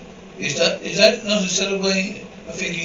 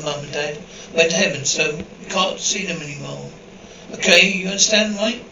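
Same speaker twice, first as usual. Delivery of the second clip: ordinary delivery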